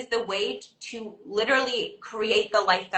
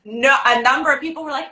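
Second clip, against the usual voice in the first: ordinary talk, nothing marked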